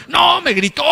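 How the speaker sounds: high mock protest; indignant little yelp. Preacher imitating his own excuse to the supervisor, mock protest.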